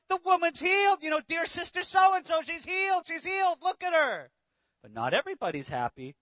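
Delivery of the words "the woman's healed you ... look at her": high-pitched, thrilled exclamation; excited onlookers. Acted. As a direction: play an amazed crowd spreading good news. Crowd thrilled at healing.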